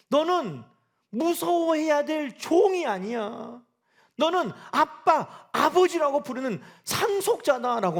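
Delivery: impassioned sermon peak; preaching climax. Acted. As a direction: drive each word home to the church. Preaching climax, slow and loud.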